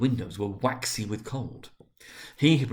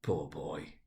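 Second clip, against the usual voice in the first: much softer and lower, sorrowful; pitying; 7 semitones lower, 9 dB quieter than usual. A narrator's reading. Scrooge pities his former self, soft and sorrowful. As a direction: say it with quiet pity. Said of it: "in pity for his former self".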